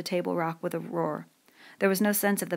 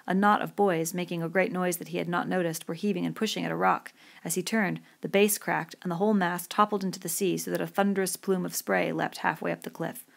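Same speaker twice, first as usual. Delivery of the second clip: plain reading voice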